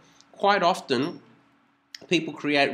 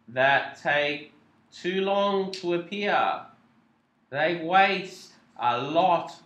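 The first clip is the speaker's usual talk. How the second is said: very slow word by word; slow reveal; much slower than usual. Shown as a demonstration. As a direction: drag each word out like a slow slide effect. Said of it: "That took, like, thirty seconds of pain".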